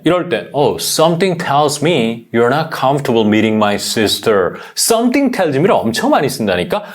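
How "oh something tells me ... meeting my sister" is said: slow demo. Shown as a demonstration. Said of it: "한 번 더".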